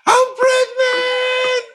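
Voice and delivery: slow, high, loud sing-song I'm pregnant; high drawn-out mock announcement. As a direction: stretch it out like a big reveal.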